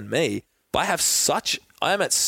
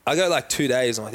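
normal talking voice

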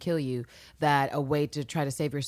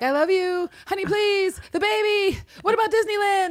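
much higher, hammed-up panicked begging; freaking out; 4 dB louder than usual. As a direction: squeal it out like a terrified husband begging for his life. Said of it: "he really starts to freak out".

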